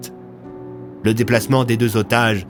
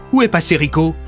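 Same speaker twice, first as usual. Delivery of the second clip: higher, urgent question; worried question; 7 semitones higher, 4 dB louder than usual. A narrator's reading. Bennett finds Rico missing, worried question. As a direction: ask anxiously after a missing friend.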